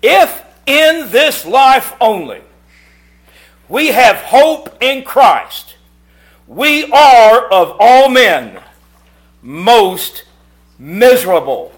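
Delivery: slow, loud, weighty reading; solemn scripture reading. Real, not acted. Preacher reading his favourite verse to the congregation, slow and loud. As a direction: lay each word down heavily.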